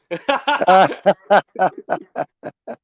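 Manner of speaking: a loud real burst of laughter; big laugh. Real, not acted. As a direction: laugh out loud at your own story. Laughs after retold jibe.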